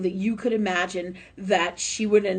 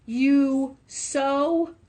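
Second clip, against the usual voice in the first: tender, drawn out; 5 semitones higher, 3 dB louder, much slower than usual. Real, not acted. Relays mother's love, drawn out.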